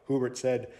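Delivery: ordinary delivery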